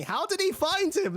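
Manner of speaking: high, loud, baffled outburst; exasperated question. Real, not acted. Reviewer baffled by a plot hole, loud and incredulous. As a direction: throw the question up in disbelief.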